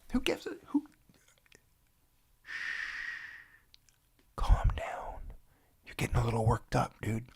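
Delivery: hushed, breathy calming whisper. Real, not acted. Hushing panicked viewers, soft whisper.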